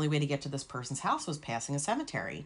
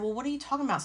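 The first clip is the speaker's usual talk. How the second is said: baffled; 9 semitones higher, much faster than usual. Retold or imitated. Confused reaction to sister.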